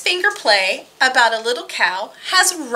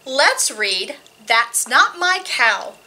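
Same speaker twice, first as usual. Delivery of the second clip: plain reading voice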